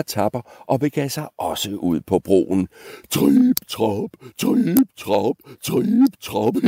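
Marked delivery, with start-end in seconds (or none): 3.1-6.5 drawn-out steps, each swooping upward; trampling hoof steps. Acted. Middle goat tramping over the bridge, lilting stomps. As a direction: stretch each trip-trap into a lilting stomp. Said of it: "genlød hans trampen på broen".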